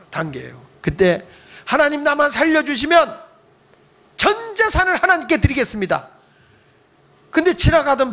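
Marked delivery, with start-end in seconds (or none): 1.6-6.1 higher, pleading cry to God; desperate plea. Retold or imitated. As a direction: beg God for your life as the ship sinks. Sinking man's final plea.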